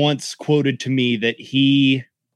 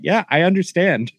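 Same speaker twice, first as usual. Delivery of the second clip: mock polite reply; 6 semitones higher than usual. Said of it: "And I was like".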